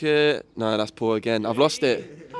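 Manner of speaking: ordinary talk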